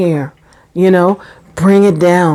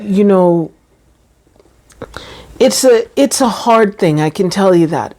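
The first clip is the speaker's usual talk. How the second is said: normal talking voice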